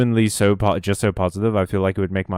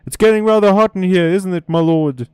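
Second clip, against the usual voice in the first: higher, louder put-on servant voice; sitcom servant; 10 semitones higher, 6 dB louder than usual. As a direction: play the loud, high-pitched servant addressing his master. Reading a Blackadder parody exchange.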